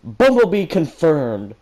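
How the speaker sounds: loud, high, slow victory cry; triumphant cheer. Acted. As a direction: punch the air with it. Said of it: "Ruby fist pumped the air".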